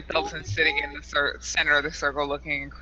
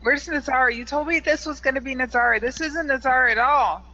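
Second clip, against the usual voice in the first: frightened man; 9 semitones higher, 4 dB louder than usual. Acted. Frightened NPC Felton protests.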